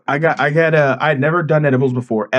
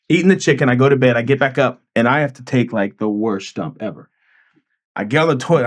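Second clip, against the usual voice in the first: usual speaking voice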